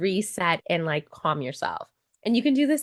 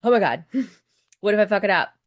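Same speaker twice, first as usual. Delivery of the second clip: fast, louder, laughing panic; mock panic; 5 dB louder, faster than usual. Voicing the gut's panic after a client pays, hammed-up fright. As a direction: rattle off the fear with a laugh. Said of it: "Does your gut go".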